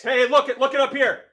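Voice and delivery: high, loud hey look up here; loud call for attention. Real, not acted. Teacher calling students back to the formula. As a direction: snap their eyes to the board.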